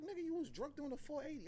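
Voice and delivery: high, amused buddy voice; friend teasing. Retold or imitated. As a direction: tease him about being drunk. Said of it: "It's like".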